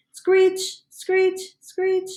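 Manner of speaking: high squealing screech sound; wheel screech. Retold or imitated. Frog narrator mimics the hamster's squeaky wheel, high screech.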